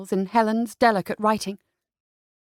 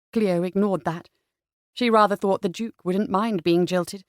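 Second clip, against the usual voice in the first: ordinary delivery, nothing marked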